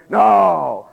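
shouted denial. Retold or imitated. Peter denying to a bystander, shouting. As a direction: bellow a mock-furious refusal.

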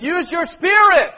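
loud, high rallying cry; exhorting shout. Real, not acted. Preacher urging the meeting to use their spirit, exhorting shout. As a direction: fire the room up, punching SPIRIT.

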